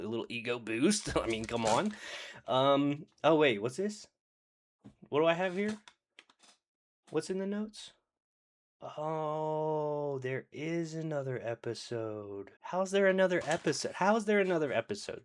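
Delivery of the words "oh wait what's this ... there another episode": high, hammed-up fake discovery; mock surprise. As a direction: pretend to stumble on the notes, higher. Feigns finding notes mid-recap.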